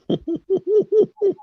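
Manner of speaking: high-pitched giggle; a squealing burst of laughter. Real, not acted. Laughing at his own hillbilly voice, high giggle.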